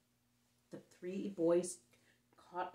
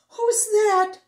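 high, loud frightened shriek; 15 dB louder than usual. Acted. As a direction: scream it in sudden terror. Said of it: "shrieked the boys".

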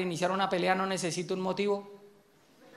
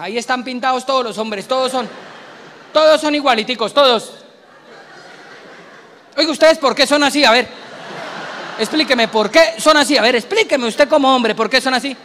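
higher, louder accusing wife; nagging wife; 4 semitones higher, 8 dB louder than usual. Acted. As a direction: scold the husband like the offended wife. Imita a la esposa reclamando. (esposa regañando al marido, aguda y fuerte)

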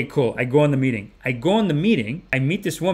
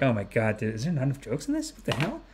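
retold private worry; 9 dB quieter than usual. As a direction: fret under your voice, as if to yourself. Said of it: "I was feeling a little bit like".

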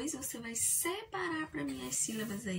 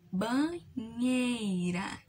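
"banheira" stretched very slowly, syllable by syllable; separated syllables; 4 dB louder, much slower than usual. Shown as a demonstration. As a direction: draw out "banheira" so each syllable stands apart. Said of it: "você vai separar".

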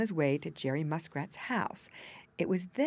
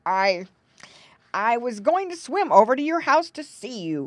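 louder, put-on old frog voice, fretting about going deaf; old frog worried; 5 semitones higher, 10 dB louder than usual. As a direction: play an anxious old frog who thinks he's deaf. Narrator voices Grandfather Frog.

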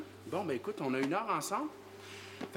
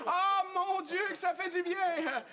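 high, exaggerated cry of relief; covering-up cry; 9 semitones higher than usual. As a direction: pass off your cries as a delighted client's. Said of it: "c'est Monsieur Gilbert qui criait". (cri de soulagement aigu et exagéré)

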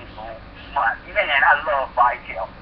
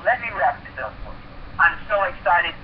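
normal talking voice

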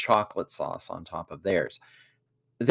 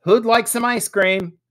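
high, loud call to the group; raised, high-pitched asking voice; loud question; 13 semitones higher, 9 dB louder than usual. A narrator's reading. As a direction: call it out loudly as an offer. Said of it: "asked Jack loudly".

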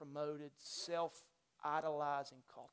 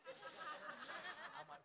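a light, high laugh; real laugh; 14 semitones higher, 11 dB quieter than usual. Real, not acted. Pastor laughing at a friend about the kayak.

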